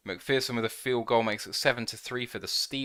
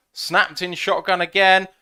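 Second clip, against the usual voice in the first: excited play call; 7 semitones higher, 9 dB louder than usual. Real, not acted.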